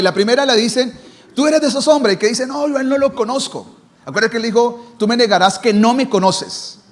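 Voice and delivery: loud, driving sermon delivery; fiery Spanish pulpit preaching; full-force preaching. Acted. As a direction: preach it to the whole church. Preaching Peter's denial story.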